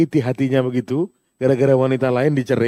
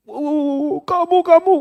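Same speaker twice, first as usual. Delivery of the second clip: mock nagging wife; 14 semitones higher, much slower than usual. Nagging wife voiced in sermon.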